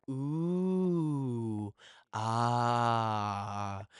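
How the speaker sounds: long, drawn-out mock "ooh" and "ah" of wonder; gaze-at-the-sky wonder, held on one breath each; awed ooh and aah. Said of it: "staring up into the sky being like".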